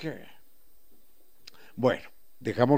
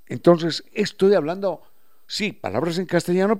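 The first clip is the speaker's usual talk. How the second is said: normal talking voice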